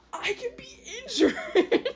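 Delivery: high, overdramatic shock; mock horrified realization. Invincible cat discovering his first scratch, high mock horror. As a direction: gasp it out high, hammed-up disbelief.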